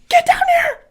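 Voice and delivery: very high, loud demanding shriek; child screaming. Retold or imitated. Mom imitating daughter screaming at her. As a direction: scream a toddler's command.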